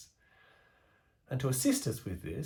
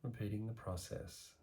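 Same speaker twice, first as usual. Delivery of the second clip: guided meditation; 5 dB quieter than usual. Acted. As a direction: ease the listener into calm, letting the voice sink softer. Guided meditation practice begins.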